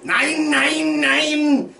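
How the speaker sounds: loud, high German nein. Shown as a demonstration. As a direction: shout it as the German word nein. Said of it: "That means no in German".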